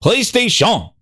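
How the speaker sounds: "PlayStation" high with a drawn-out ending; Satya, grandly enunciated. Acted. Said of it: "Satya's like".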